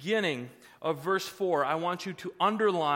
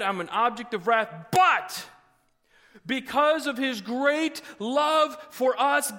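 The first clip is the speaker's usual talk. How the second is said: higher, louder, slow preaching build to a shout; preaching at full force; 6 semitones higher, 4 dB louder, slower than usual. Acted. Pastor proclaiming God's gift of life, full-force preaching.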